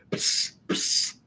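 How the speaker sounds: unvoiced spitting psst sounds. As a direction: spit the coffee out in disgust. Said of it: "he spat".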